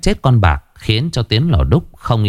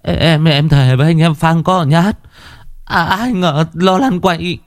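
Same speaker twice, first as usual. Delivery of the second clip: whiny pleading, higher; whining; 7 semitones higher than usual. Acted. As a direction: grimace and plead your case to the angry boss. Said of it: "Bảo Còi nhăn nhó".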